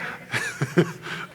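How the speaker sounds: hammed-up helpless laughing with an "ooh"; prankster's laughter. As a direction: crack up at your own scare. Imagined Jesus laughing at scared friends.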